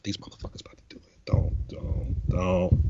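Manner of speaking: hushed, breathless don'ts to the screen; urgent whisper. Retold or imitated. Whispering to the TV character so he won't hand over the paper. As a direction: whisper it like the TV can hear.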